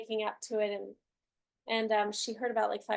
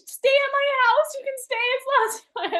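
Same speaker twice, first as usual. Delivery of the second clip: high, loud squeal of an offer; hammed-up gushing shout; retold excited invitation; 12 dB louder than usual. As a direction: burst out with an overeager invitation.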